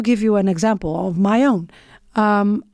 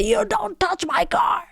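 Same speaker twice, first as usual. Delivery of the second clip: high-pitched yell, held back in volume; re-enacted scream; 10 semitones higher, 3 dB quieter than usual. Driver repeating the warning to a surprised cyclist, high mock scream. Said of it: "I can scream as well".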